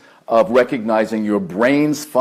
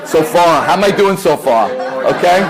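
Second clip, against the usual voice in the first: higher and louder, calling out; louder repeat; 6 semitones higher, 5 dB louder than usual. Real, not acted.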